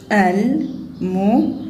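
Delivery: الملونة chopped into separate syllables. Shown as a demonstration. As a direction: sound out الملونة piece by piece. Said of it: "الملونة سنقطعها هنا".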